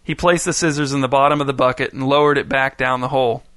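ordinary delivery

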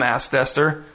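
usual speaking voice

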